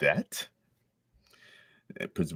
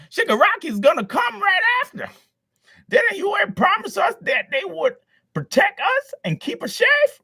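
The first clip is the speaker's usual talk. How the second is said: much higher, louder falsetto crowd voice; angry crowd; 5 dB louder than usual. Acted. Crowd shouting at Deku to stay out, shrill shout. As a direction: shriek it as a panicked townsperson.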